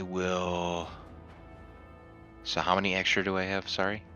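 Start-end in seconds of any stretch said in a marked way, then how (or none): none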